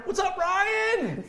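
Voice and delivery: high, drawn-out greeting; excited shout-out. Real, not acted. Host greeting viewer Ryan, excited shout-out. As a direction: call it out to a friend watching at home.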